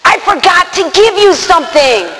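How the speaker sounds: high, loud holler; imitated yelling from a distance, every word punched; calling out. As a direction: shout it up to the loft. Man calling up to her, retold.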